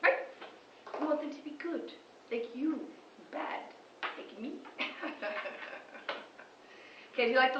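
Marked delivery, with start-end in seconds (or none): 1.0-4.6 soft, hushed and sly; teasing whisper. Acted. Vlogger confides to viewers in a sly soft whisper. 4.8-6.2 soft breathy laugh. Real, not acted.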